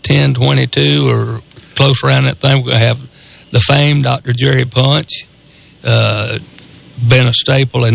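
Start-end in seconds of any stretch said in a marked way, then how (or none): none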